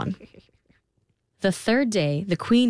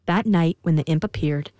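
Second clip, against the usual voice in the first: ordinary delivery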